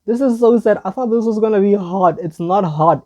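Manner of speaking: loud, high-pitched protest; exasperated dismay. Real, not acted. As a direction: blurt it out in dismay. Dismayed that every video is sad.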